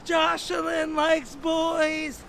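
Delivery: high, drawn-out jeering shout; crowd yelling. Retold or imitated. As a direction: holler it slowly like a taunting crowd. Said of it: "Everybody's yelling".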